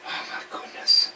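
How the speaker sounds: whispered oh my goodness; sighing it out in dismay; breathy dismay. Real, not acted. Fish keeper whispering dismay at his own mistake.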